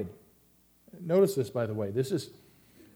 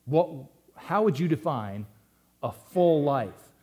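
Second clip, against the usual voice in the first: slow drawn-out "a full life"; posed question; 3 dB louder, slower than usual. Acted. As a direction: ask it slowly, as to the congregation. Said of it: "if I were to say to you".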